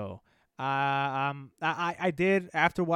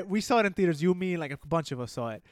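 ordinary talk, nothing marked

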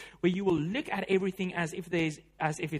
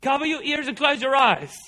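higher, louder parental order; a parent during a scary movie scene, commanding loudly; parent's command; 5 semitones higher, 8 dB louder than usual. Retold or imitated. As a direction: tell the kids firmly what to do. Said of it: "what do parents always tell the kids".

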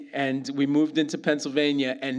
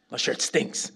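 mutter; 2 semitones lower than usual. Retold or imitated. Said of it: "He goes".

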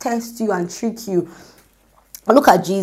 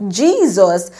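usual speaking voice

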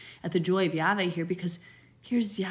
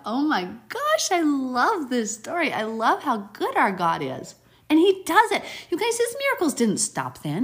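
thrilled; 8 semitones higher, 6 dB louder than usual. Real, not acted. Preacher bursting with joy over the story. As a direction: gush with delight.

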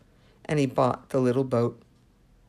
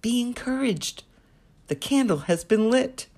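gentle divine encouragement; 9 semitones higher than usual. Acted. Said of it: "I heard him say".

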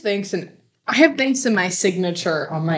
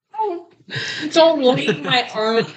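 laughing; 5 semitones higher than usual. Real, not acted. Embarrassed, laughing.

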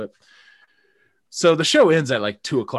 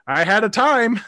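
higher, louder deadpan answer; dry unimpressed date; 10 semitones higher, 7 dB louder than usual. Retold or imitated. Date summing up the night, higher dry answer. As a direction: say it pointedly flat-polite. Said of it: "she's like".

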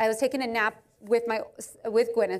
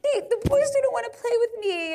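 put-on high whiny kid voice, much higher; whining child; 11 semitones higher than usual. Mother imitating her crying kid complaining, high whine. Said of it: "And I, like, go".

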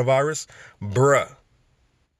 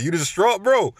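mocking question; 7 semitones higher than usual. Mocking someone who says distraught.

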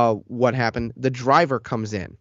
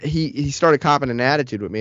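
ordinary talk, nothing marked

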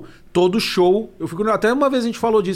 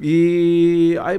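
ordinary talk, nothing marked

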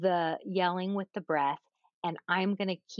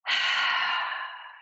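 deep audible sigh. Shown as a demonstration. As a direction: breathe out audibly to calm down. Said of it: "all of a sudden you're going".

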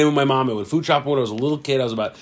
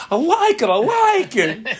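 much higher put-on cartoon squeal; cartoon character voice; slower than usual. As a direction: squeal it like a wound-up Looney Tunes character. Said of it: "and he says".

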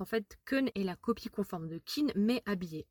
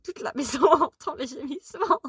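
laughing; 5 semitones higher, 6 dB louder than usual. Real, not acted. Laughs mid sentence.

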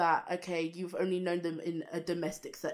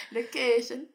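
mocking repeat; 5 semitones higher than usual. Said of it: "Why you say it like that?".

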